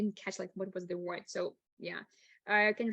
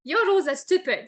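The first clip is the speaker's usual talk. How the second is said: higher, louder mock protest; mock complaint; 8 semitones higher, 7 dB louder, much faster than usual. Someone objecting to the teacher's rules, mock protest. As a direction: blurt it out as an exaggerated objection.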